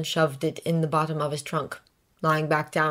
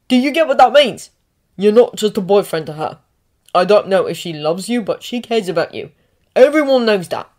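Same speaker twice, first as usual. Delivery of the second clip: heated protective outburst; 7 dB louder than usual. Acted. Ron's emotional protective speech to Harry.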